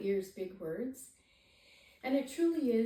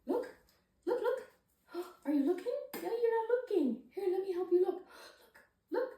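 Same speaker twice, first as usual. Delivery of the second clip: put-on high, bright sing-song to a baby; excited baby talk; 8 semitones higher, 4 dB louder than usual. Shown as a demonstration. Parent showing a baby a book, in high excited baby talk. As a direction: coax the baby to look, pitched high. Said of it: "and maybe saying".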